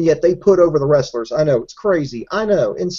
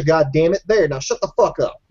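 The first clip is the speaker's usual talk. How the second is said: usual speaking voice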